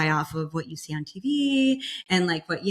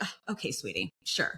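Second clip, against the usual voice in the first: condescending nurses; 6 semitones lower, 8 dB quieter than usual. Retold or imitated. Nurses humoring sister.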